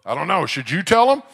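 loud playful father, rising at the end; dad in a skit. Retold or imitated. Daddy answering his daughter in their skit, playful dad voice. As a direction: toss the question loudly back to the child. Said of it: "A skit called Should I Tell Him".

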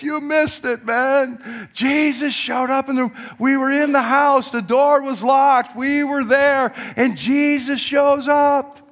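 bursting to tell the news, rising into a shout; excited disciples. Retold or imitated.

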